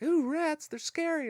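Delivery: high-pitched squeamish imitation; mock scared. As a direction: mimic silly frightened people, way up high. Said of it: "all these people are like".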